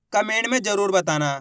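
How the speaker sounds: ordinary delivery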